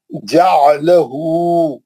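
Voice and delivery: slow, drawn-out Quranic recitation. Shown as a demonstration. Teacher recites word slowly for class. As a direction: chant each syllable slowly for students. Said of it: "जल्दी जल्दी पढ़ना उसको".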